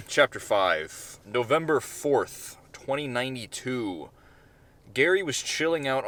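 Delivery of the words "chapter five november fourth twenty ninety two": slow, measured chapter title; chapter heading. A narrator's reading. Narrator announces the new chapter, slow and measured.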